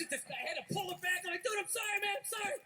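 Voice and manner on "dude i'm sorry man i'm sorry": high, urgent apologizing; acted flustered pleading; panicked apology. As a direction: apologize frantically to a stranger. Retold apology while pulling dog back.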